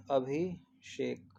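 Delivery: अभिषेक slowly split as abhi - shek; slow syllable-by-syllable अभिषेक; correct pronunciation. Shown as a demonstration. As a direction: break अभिषेक in two, abhi, then shek. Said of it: "अभिषेक होता है".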